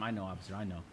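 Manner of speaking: just agree quietly to calm the officer; placating the officer so he won't get mad; meek, submissive reply. Retold or imitated. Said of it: "this is what I'm telling him".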